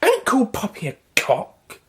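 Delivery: put-on high, slow, prim old woman voice; a scolding old lady, drawn out.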